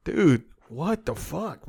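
ordinary delivery

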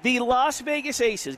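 usual speaking voice

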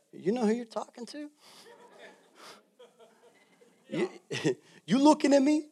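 high, pushy Italian-mom challenge. Retold or imitated. Pastor imitating his Italian mom: high, pushy challenge. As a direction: square up like a tough Italian mother. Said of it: "I'm gonna go like my mom".

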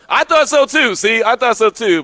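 delighted agreement. Real, not acted. Reacting to co-host agreeing.

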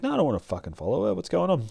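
high, fast, whiny refusal; whiny protest. Acted. Blonde refusing her pimp's order, high and whiny. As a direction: rattle it off high and fast, complaining.

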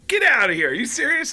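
can't believe his luck; yelping mock surprise; delighted disbelief. Comedian hears he has a fan.

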